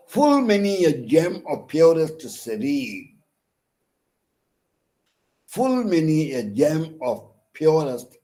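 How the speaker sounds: "Full many a gem" recited high in weak-strong beats; metrical recitation. Shown as a demonstration. Demonstrating metre by reciting verse.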